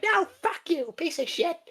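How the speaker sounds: high squeaky mock princess; high-pitched spoiled brat insult; bratty princess cursing. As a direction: spit it out in a tiny whiny voice. Princess insults orange guy.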